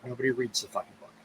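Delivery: a fast, bitter aside under the breath; muttered aside. Real, not acted. Bitter aside about the Bible.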